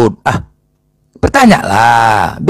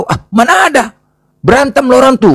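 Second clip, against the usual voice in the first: dismissive denial; 10 semitones higher than usual. Acted. Seller denies, brushes it off.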